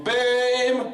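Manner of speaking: hammy endearment. Acted. High loud endearment.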